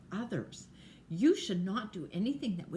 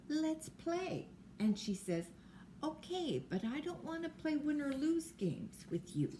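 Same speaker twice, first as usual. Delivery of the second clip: put-on child voices; 6 semitones higher than usual. Friends make up.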